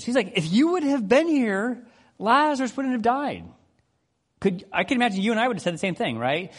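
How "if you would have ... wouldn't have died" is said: pushy confrontation. Retold or imitated. Martha confronting Jesus over Lazarus's death, pushy reproach. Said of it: "she's kinda drunk with Jesus".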